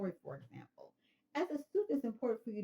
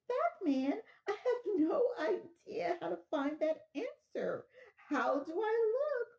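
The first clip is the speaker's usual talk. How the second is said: much higher, slow, half laughing half sobbing; hysterical caller; 9 semitones higher, 5 dB louder, much slower than usual. Acted. Caller to the operator, high and laugh-sobbing in mock distress. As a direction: fall apart on the phone, laughing through mock tears.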